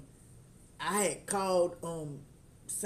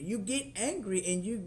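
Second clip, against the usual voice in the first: ordinary talk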